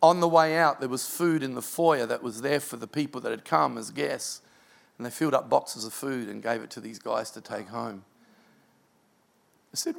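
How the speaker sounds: usual speaking voice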